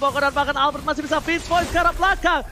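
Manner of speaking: fast, rising hype play-by-play; fighting game announcer. Acted. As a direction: call the teamfight like it's the finals. Hyped play-by-play of a teamfight. (komentator esports yang heboh)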